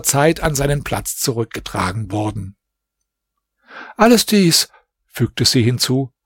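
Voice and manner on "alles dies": put-on higher, shaken young princess voice; plead, still stunned by the night; anxious young princess begging forgiveness; frightened princess.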